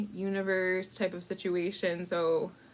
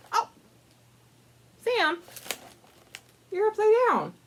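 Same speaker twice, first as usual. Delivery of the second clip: surprised exclamation; 12 semitones higher than usual. Real, not acted. Reader surprised at the upside-down picture, voice jumps high. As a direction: pitch jumps up in sudden surprise.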